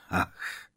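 soft, voiceless sighed "ach". A narrator's reading. Headmaster ponders the boy's question, sighing softly. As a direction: let out a quiet breathy sigh. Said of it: "povzdechl si Brumbál".

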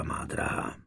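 soft, breathy whisper of the endearment; whispered aside. A narrator's reading. Husband whispering softly to his dear wife. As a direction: murmur it close, almost unvoiced.

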